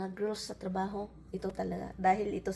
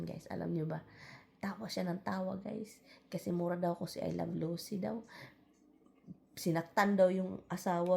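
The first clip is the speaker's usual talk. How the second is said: ordinary delivery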